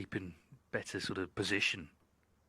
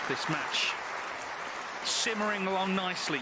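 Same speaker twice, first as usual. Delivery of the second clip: excited; 10 semitones higher, 4 dB louder than usual. Real, not acted. Frame won, match summary.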